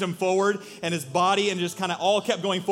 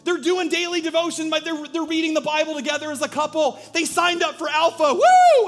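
high, loud jubilant shouting to the angels; excited heavenly father; 12 semitones higher, 8 dB louder than usual. Retold or imitated. God calling the angels to watch his child, high jubilant shouts. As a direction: call all heaven to look, overjoyed. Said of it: "He's like".

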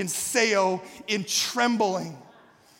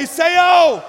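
high, loud drawn-out shout; rousing shout; 7 semitones higher, 11 dB louder than usual. Shown as a demonstration. Preacher telling congregation to shake the other neighbor, rousing shout. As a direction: belt it out to rouse someone.

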